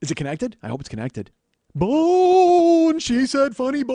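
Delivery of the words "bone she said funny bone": high spooky ghost voice; drawn-out loud wail; ghostly wail. Pretend. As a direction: howl it like a ghost, long vowels. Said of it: "ghostly flange".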